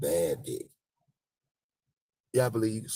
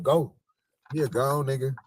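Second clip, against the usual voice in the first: higher, louder go on; mock shooing woman; 6 semitones higher, 6 dB louder than usual. Woman dismissing him after the hug, higher loud. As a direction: send him off quickly.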